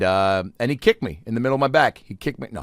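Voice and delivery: ordinary talk, nothing marked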